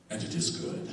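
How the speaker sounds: low hushed solemn proclamation; dad's pronouncement. Retold or imitated. Dad surveys sorted bike parts, solemn hushed verdict. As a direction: intone it like a creator admiring his work. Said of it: "and he says".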